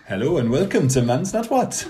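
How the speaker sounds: badly faked Welsh, sliding toward Indian; attempted Welsh accent. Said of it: "So yours is Welsh".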